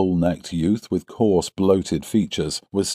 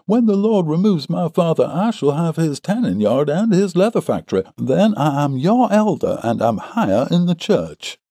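high, emphatic boasting voice; heated; 10 semitones higher, 4 dB louder than usual. A narrator's reading. Suitor boasts of coming inheritance to rival, heated. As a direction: press your advantage, pitched high and louder. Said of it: "said the other warmly".

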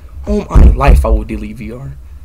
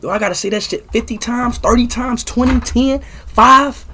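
climbing higher and louder with each number; from grumbling to a loud exasperated peak; building exasperation; 9 semitones higher, 3 dB louder than usual. Real, not acted. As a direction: get more fed up with every count. Exasperated at spamming chat.

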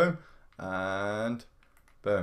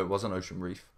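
ordinary talk, nothing marked

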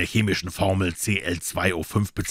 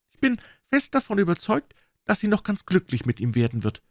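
mother; 12 semitones higher than usual. A narrator's reading. Mother talks to Manfred.

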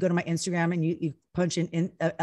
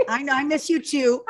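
higher, louder mock reassurance; playful placating; 7 semitones higher, 5 dB louder than usual. Wife answering her left-out husband, playfully placating. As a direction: placate with exaggerated insistence.

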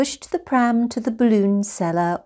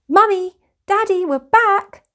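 high, loud happy shout home; 11 semitones higher, 5 dB louder than usual. Acted. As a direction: call out to her parents from afar. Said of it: "shouted Lucy".

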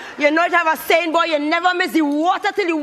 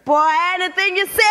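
ordinary delivery

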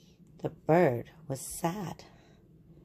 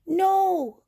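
put-on high, loud child's No; crying out; 14 semitones higher, 5 dB louder than usual. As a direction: protest sharply, high and loud. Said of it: "cried Mole".

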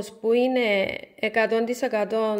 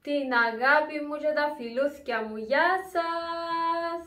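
bright, higher sing-song farewell; cheery goodbye; 5 semitones higher than usual. Real, not acted. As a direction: wave the viewers off with a lift in the voice. Cheerful sign-off to viewers. (χαρούμενο αποχαιρετιστήριο, πιο ψηλά)

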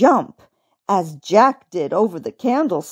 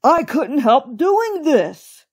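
slow, higher-pitched protest; protesting cry; 5 semitones higher, slower than usual. A narrator's reading. Old rabbit explaining his slip, slow raised protest. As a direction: draw it out, pleading innocence. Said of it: "he cried".

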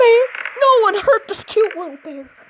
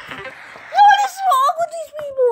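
higher, crying-out frustration; exasperated whine; 11 semitones higher than usual. Real, not acted. Gamer upset at other players, exasperated whine.

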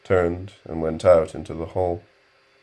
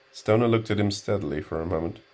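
plain reading voice

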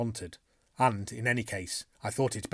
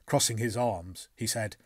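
plain reading voice

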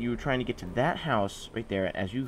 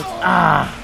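loud high cry; scream; 9 semitones higher, 12 dB louder than usual. Real, not acted. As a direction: scream as you die. Dies, scream.

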